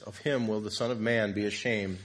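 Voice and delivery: normal talking voice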